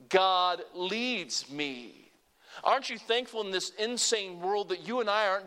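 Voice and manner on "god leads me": even slower, words stretched; slow call to repeat. Shown as a demonstration. Preacher slowly leading the congregation's response. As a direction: lead them word by word. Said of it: "Say it with me".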